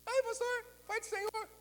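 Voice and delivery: high squeaky imitation of a church sister; mock sister's whine. Pastor mocking a pestering church sister, high squeaky whine.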